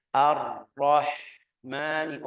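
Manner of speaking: "Ar-Rahmani" very slow, with a long airy h; correct drawn-out ha. Shown as a demonstration. Teacher modelling for learners, slow breathy ha. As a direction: stretch "Ar-Rahmani" syllable by syllable, breathing out the h.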